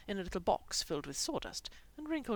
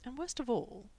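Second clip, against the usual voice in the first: ordinary delivery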